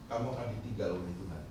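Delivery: low, quiet, quick devil's voice, lightly done; quick low murmur as the devil; devil's taunt. Retold or imitated. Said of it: "Iblis ngomong sama saya begini".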